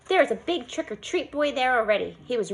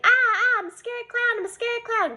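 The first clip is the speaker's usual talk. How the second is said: mock screaming; 10 semitones higher than usual. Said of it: "I kept on screaming".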